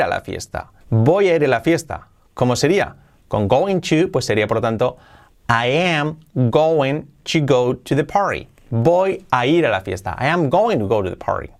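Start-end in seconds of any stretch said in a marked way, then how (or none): 5.5-8.5 slow, careful English line; slow model sentence. Shown as a demonstration. Teacher modelling going to example, slow and careful. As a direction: read I am going to go to the party slowly. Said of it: "model sentence read slowly".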